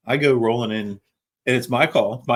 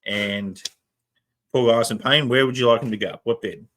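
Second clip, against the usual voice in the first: Australian paramedic giving a report; Australian accent. Retold or imitated. Paramedic reporting to nursing staff in an Australian accent. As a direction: give the handover in an Australian accent. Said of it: "in the Australian accent".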